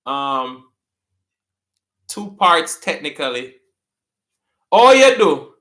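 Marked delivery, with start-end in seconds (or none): 4.7-5.6 loud, high patois "Oh, you do"; Jamaican patois. Shown as a demonstration. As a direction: greet someone in patois. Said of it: "someone walk up to you and they say".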